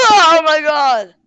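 big gasp, then loud drawn-out cry; loud, startled wail; shocked cry. Real, not acted. Startled by accident in game.

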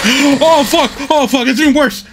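yelling high in panic; high panicked yell. Real, not acted. Gamer shrieking at Leon who left him.

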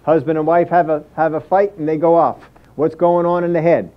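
ordinary talk

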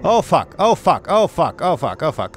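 sudden loud panicked curse; panicked swearing. Real, not acted. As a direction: blurt it out in real fright. Panic at sudden dragon attack.

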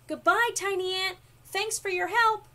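high, loud thankful farewell; shouting back. A narrator's reading. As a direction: call after a departing friend. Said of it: "Wiggle Worm shouted back".

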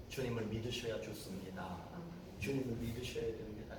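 low, soft, gentle appeal; quiet and humble. Shown as a demonstration. Preacher showing how to evangelize, quiet humble appeal. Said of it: "조용하게 겸손하게".

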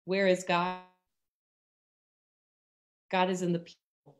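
ordinary delivery

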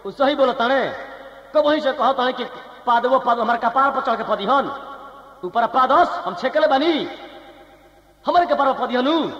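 high, heated Bihari outburst; angry. Acted. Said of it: "Bihari भाई लोग गर्म हो गए".

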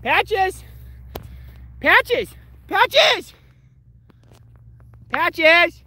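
loud high call across the yard; shouting "Patches!" for the dog. Real, not acted. Calling his dog.

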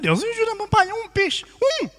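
high-pitched begging to God; comic, high, desperate prayer; desperate plea. Acted. Desperate fisherman pleading to God.

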